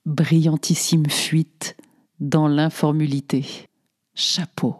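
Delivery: slow whisper of mock awe; whispered, slow admiration. Real, not acted. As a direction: hush it like a secret compliment. Éloge ironique murmuré. (murmure lent, admiratif et ironique)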